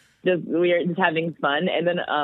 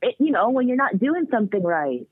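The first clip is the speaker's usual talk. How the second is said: higher child voice, hint of Scottish accent; little Scottish girl; 7 semitones higher than usual. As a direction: explain like a small child with a Scottish lilt. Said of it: "she has a little Scottish accent".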